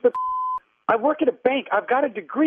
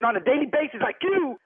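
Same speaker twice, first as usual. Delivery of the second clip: usual speaking voice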